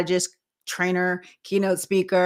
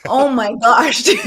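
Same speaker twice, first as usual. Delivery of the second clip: laughing; 9 semitones higher, 10 dB louder than usual. Real, not acted. Laughing at host's teasing.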